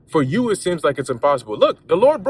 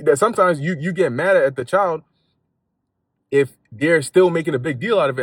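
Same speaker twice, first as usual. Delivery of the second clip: ordinary delivery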